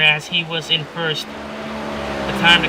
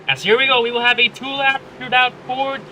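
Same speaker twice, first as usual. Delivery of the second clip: motorsport commentator; 5 semitones higher than usual. Acted. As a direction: call the restart with mounting excitement. Race restart play-by-play.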